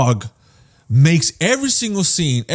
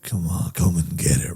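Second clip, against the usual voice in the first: low, soft mock-mad taunt; mock menace; 9 semitones lower, 5 dB quieter than usual. Reviewer voicing the mad dog, low quiet menace. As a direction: dare them quietly, pitched down. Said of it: "when he's mad, he's like".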